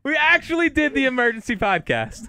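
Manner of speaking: high, loud gleeful cheer; excited. Real, not acted. Gleeful shout back.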